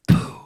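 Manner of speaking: "Poo" with a breathy aspirated P, not a B; "Poo, poo" puffed with air; wrong poo kick. Shown as a demonstration. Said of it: "sure you're not saying poo".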